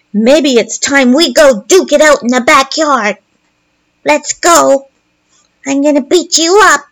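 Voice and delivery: lightly voiced bratty kid; five-year-old taunt. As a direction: taunt like a kid picking a fight. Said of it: "this is like a five-year-old".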